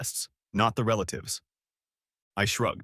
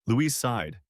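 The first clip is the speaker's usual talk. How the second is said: usual speaking voice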